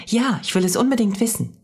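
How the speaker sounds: put-on high-pitched eager child; excited little girl, high voice. A narrator's reading. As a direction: burst out eagerly, pitch well up. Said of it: "Aufgeregt rutschte Mia".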